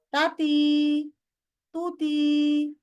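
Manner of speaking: slow drawn-out আত্তি আত্তু with vowel lengths gone wrong; careless emotional reading. Shown as a demonstration. Teacher imitating students who read by emotion, drawn out. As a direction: mimic a careless reader stretching আত্তি. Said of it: "মনে করে".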